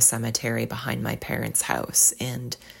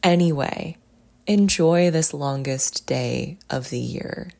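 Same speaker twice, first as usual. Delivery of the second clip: normal talking voice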